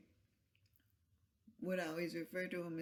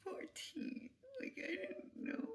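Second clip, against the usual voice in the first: high whisper; 13 semitones higher, 7 dB quieter than usual. Real, not acted. Reacting to a sad story.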